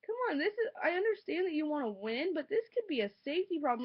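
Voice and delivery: high, loud pleading at a rider who won't move; exasperated plea. Retold or imitated. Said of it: "I'm sitting here like".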